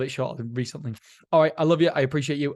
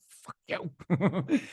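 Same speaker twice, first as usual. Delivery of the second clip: laughing, playful retort; fake-offended comeback through laughter; joking back at his wife, laughing; mock offended; 5 semitones higher than usual. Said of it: "I'm like".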